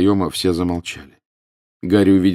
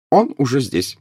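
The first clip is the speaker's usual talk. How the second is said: higher, alert professor; sudden announcement; 8 semitones higher, 3 dB louder than usual. A narrator's reading. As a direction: break in suddenly. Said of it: "внезапно сказала профессор МакГонагал".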